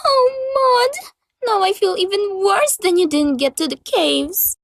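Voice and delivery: high, crying regret; weepy remorse; tearful guilt. Acted. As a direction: choke out the guilt. Guilt over friend's disappointment.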